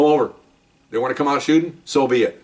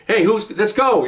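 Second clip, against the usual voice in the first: high, slow, louder call; loud wrong way; 9 semitones higher, slower than usual. Shown as a demonstration. Showing how not to call to fellow officers, loud. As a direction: announce it the wrong way. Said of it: "you don't do that".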